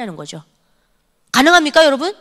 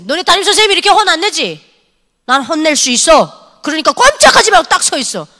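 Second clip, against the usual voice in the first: stern scolding; 5 semitones higher, 5 dB louder than usual. Retold or imitated. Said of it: "북한 선생님이니까 이렇게 하지".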